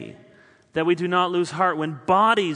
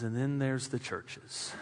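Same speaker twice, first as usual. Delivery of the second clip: much softer and lower; quiet weary punchline; 5 semitones lower, 10 dB quieter than usual. Acted. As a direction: let it fall away, tired. Quoting Paul, drops to near whisper.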